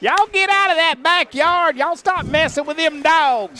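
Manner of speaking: put-on high, loud granny hollering at kids; old Southern grandma. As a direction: scold from the porch like a Southern grandma. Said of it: "old Southern grandma".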